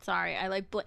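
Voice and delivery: ordinary talk, nothing marked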